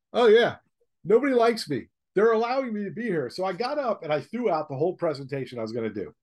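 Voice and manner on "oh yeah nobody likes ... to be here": high, loud, wry resignation; sarcastic inner thought. Retold or imitated. Speaker voicing his thoughts in an unfriendly room, higher and louder, wryly resigned. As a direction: toss it off as a mock-cheerful aside.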